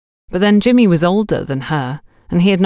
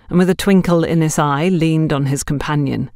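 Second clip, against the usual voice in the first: ordinary delivery, nothing marked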